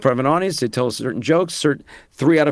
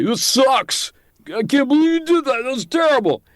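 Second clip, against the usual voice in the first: high, disdainful put-down voice; scornful critic; 14 semitones higher than usual. Retold or imitated. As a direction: sneer it like a harsh critic. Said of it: "a percentage of those people will say".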